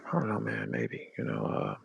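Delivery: low, soft, hesitant imitation; mock his quiet, hedging interview answer; put-on low murmur with hesitations; Mickey's dropped voice. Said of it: "your voice tone dropped".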